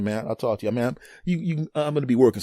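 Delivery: ordinary delivery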